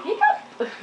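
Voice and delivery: loud fake hiccup, high pitched; mock loud hiccup. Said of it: "really loudly".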